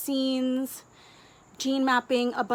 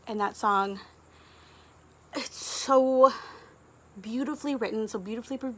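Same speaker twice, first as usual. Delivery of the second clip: normal talking voice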